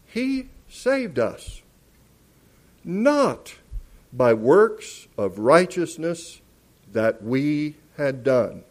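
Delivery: emphatic, measured verse reading with weighty pauses; scripture reading. Real, not acted. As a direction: proclaim the verse phrase by phrase. Reads the scripture aloud.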